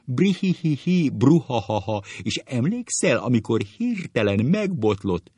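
high, loud hammed-up cackles; acted breathless hilarity; choking with laughter. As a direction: gasp out a memory through mock guffaws. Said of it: "Gümőke fuldokol".

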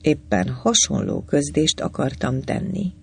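usual speaking voice

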